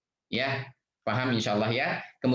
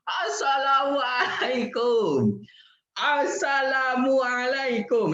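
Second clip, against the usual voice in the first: greeting shouted high and slow; microphone shout; 12 semitones higher, slower than usual. Pretend. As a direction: blast it out like into a mic. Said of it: "terus kemana-mana".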